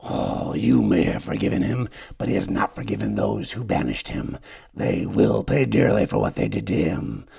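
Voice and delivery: a lightly croaked warning; croaking. Acted. Sychus croaks a warning to the hero. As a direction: croak out the threat. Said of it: "croaks Sychus".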